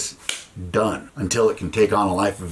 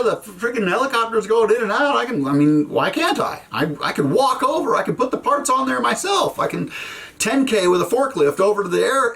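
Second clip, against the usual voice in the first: high-pitched squeaky mock outrage, much higher throughout; mock disbelief; 13 semitones higher than usual. Soldier mocking his own disbelief on the phone, high squeaky outrage. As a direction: play it like he can't believe what he's hearing.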